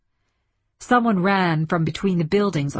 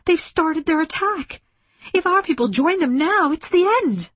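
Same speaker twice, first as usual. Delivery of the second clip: high, urgent dread; alarmed thought; 11 semitones higher, 3 dB louder than usual. A narrator's reading. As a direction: pitch it high, as if disaster has begun. Alarmed thought as attack begins.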